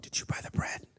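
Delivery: breathy stage whisper between disciples. Retold or imitated. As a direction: whisper it so Jesus won't hear. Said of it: "they could have been whispering".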